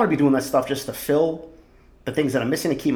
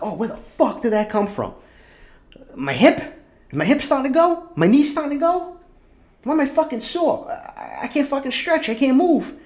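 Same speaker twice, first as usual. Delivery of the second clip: mock alarmed self; 12 semitones higher than usual. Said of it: "I'm like".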